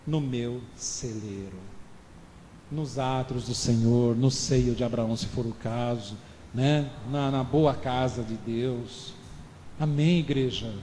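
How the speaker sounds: low, soft, slow solemn voice; hushed solemn preaching. Acted. Preacher speaking of God's house, low, soft and reverent.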